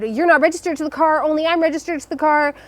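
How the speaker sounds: freaking out. Retold or imitated. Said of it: "this guy is freaking out".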